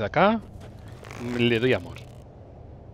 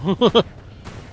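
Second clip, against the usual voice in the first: risa divertida; 6 semitones higher, 3 dB louder than usual. Real, not acted. Se ríe persiguiendo al enemigo.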